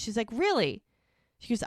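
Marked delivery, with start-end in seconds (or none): 0.3-0.8 skeptical. Retold or imitated. Disbelieving his excuse about calling.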